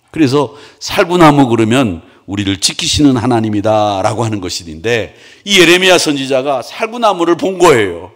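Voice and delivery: normal talking voice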